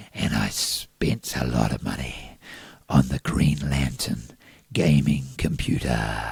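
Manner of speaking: deep, breathy, awestruck hush; breathless voice. Acted. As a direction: breathe the words out low and hushed. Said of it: "That was my breathless voice".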